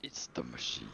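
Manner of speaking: deep cartoon villain growl; menacing Doctor Claw style line. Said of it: "Inspector Gadget villain voice".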